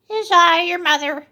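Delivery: wolf faking a sweet mother voice; soft sweet voice. A narrator's reading. Wolf at the door fooling the kids, put-on sweet voice. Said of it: "in his soft, sweet voice".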